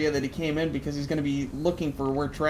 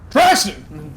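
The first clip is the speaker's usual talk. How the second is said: loud call for a missing companion; yell; 9 dB louder than usual. Acted. Player calls for lost Traston in the sandstorm, yelling. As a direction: yell out the name to be heard far off. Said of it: "yell at the top of my lungs".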